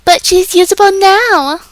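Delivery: high, loud mocking yell; hammed-up protest at a high pitch; screaming apologists. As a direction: scream it like desperate defenders, mocking them. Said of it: "the apologists are screaming".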